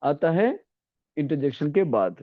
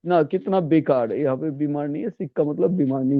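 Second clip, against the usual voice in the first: ordinary talk, nothing marked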